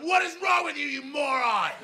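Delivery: high, mock yell at the twins; shouting at three-year-old twins in his head; imagined shout. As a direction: bark it at a toddler. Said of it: "shout at them".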